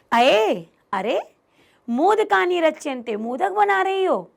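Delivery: rising, eager exclamation; light up at the sweets; excited Chandan. Acted. Chandan spots his wife making laddoos, eager and delighted.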